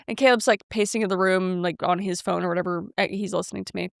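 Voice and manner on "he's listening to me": normal talking voice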